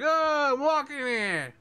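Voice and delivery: very high, squawky crowd cry; clamouring rat. Acted. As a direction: squeal it as a whole pack of rats. The other rats answer together.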